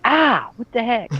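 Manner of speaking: high, slow startled complaint; mock indignation at being hit; surprised yelp. Kid reacts after the orange hits him. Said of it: "I was like".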